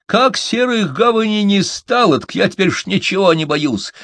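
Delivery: very high, loud outburst; indignant protest. A narrator's reading. Малыш protests after being scared, high and loud. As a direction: insist on it at high pitch. Gnome protests he fears nothing now.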